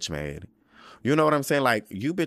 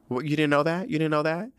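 mock surprise; 7 semitones higher, faster than usual. Acting out Larys's fake surprise.

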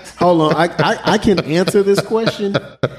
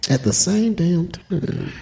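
slow drawn-out echo; 3 semitones higher, 5 dB quieter, much slower than usual. Real, not acted. Echoing the question, slowed.

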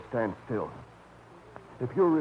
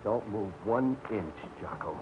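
low threat; 6 semitones lower, 9 dB quieter, slower than usual. Acted. Armed man threatens from behind.

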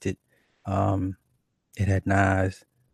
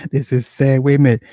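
louder, higher, full-voiced outburst; loud exclaim; 4 semitones higher, 7 dB louder than usual. Real, not acted. Streamer laughing at his chat's question, exclaiming loudly. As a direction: throw it out to the whole room. Reacting to his own chat topic.

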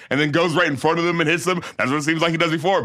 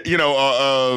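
ordinary delivery

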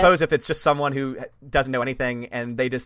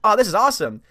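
excited; 7 semitones higher, 5 dB louder than usual. Retold or imitated. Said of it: "I was like".

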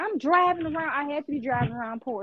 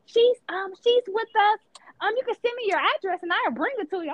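imitating the neighbor lady; 7 semitones higher, 3 dB louder than usual. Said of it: "She like".

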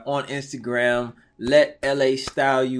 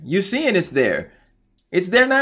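loud, high, fired-up preaching; urgent, rising exhortation; 7 semitones higher, 6 dB louder than usual. Real, not acted. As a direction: build it up like a preacher. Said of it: "watch you preach to me".

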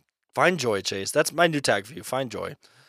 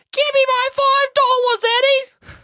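very high, loud cartoon ghost voice; demanding ghost; 6 dB louder than usual. A narrator's reading. Old friend's ghost demands his money back from Eddie, high demanding squawk. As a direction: squeal out the demand like a little goblin. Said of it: "Joe's voice would haunt him".

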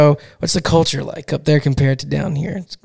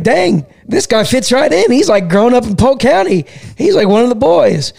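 surprised delight; 8 semitones higher, 3 dB louder than usual. Retold or imitated. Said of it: "I'm like".

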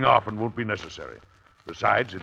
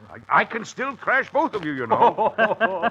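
laughing boast; 11 semitones higher, 3 dB louder, slower than usual. Acted. Father boasting during horseplay.

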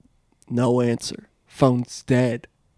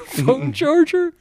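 laughing repeat; 11 semitones higher, slower than usual. Retold or imitated.

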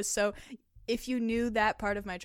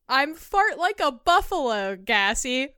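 rising louder and higher; building excitement; 7 semitones higher, 10 dB louder, slower than usual. Acted. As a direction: build it up, more and more outrageous. Reading gassy confession, cracking up.

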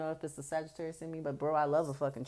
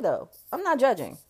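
usual speaking voice

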